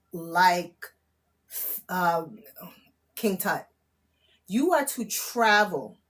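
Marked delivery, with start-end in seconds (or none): none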